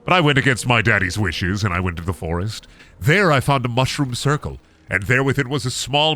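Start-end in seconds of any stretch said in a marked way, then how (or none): none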